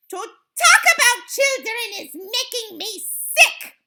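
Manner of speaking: high, loud disgusted scream. A narrator's reading. Grand High Witch sickened by children, screamed. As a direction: scream in revulsion. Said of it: "screamed the Grand High Witch".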